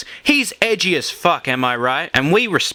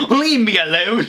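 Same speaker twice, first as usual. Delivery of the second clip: laughing mock rant; 7 semitones higher, 4 dB louder than usual. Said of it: "I sounded like Paul Joseph Watson".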